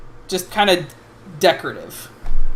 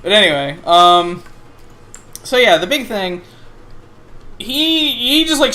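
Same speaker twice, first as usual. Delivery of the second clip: normal talking voice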